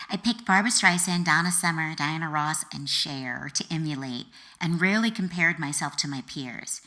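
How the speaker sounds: usual speaking voice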